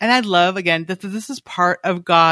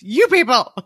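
mock scold; 4 semitones higher than usual. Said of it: "I'd say that to my kids".

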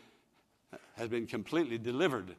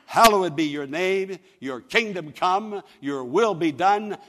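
loud, slow, declaimed prayer; preached prayer; 4 semitones higher, 10 dB louder than usual. Shown as a demonstration. Preacher showing listeners how to pray, declaimed prayer. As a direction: proclaim each phrase slowly and strongly.

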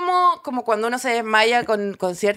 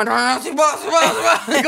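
rushed, babbled insistence; mock-stubborn, refusing to admit weakness; frantic denial. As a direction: babble it like a dazed fighter. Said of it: "nunca fue como directo a la vulnerabilidad".

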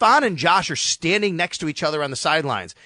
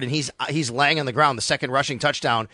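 usual speaking voice

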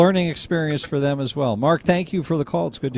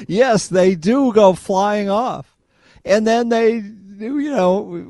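laughing; 7 semitones higher, 5 dB louder, much slower than usual. Real, not acted. Laughing comment on windmills.

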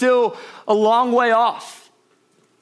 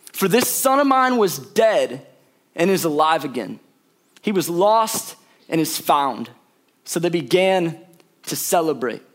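solemn scripture reading; 6 semitones lower than usual. Acted. Reads scripture slowly.

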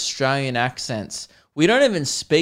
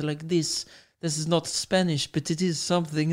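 softer, put-on Latin accent; mock sexy Spanish accent; 3 semitones higher, 6 dB quieter than usual. Host imitating appealing languages, mock Spanish-like accent. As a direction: purr it in a vague Spanish-style accent. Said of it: "the ones where they use their hands".

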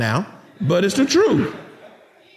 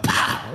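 explosive, voiceless "pow" slap noise; imitated slap; 3 dB quieter than usual. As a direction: puff out a sudden smack sound.

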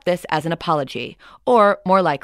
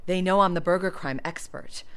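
usual speaking voice

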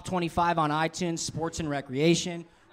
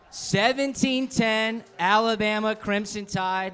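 shout; 5 semitones higher, slower than usual. Real, not acted. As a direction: belt the score out slowly. Repeating guest's pick loudly.

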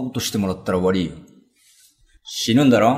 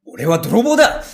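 higher, louder declaration of who he is; thief's loud boast; 7 semitones higher, 4 dB louder than usual. Acted. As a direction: announce yourself as a thief, too loud. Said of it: "ダメだよ、大きな声出しちゃ".

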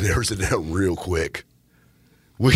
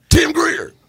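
hype announcement; 8 dB louder than usual. Real, not acted. Hyped announcement of guest.

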